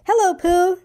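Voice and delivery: high squeak of a little joey; squeaky. A narrator's reading. Roo greeting Pooh at the party, high squeak. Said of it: "he squeaked".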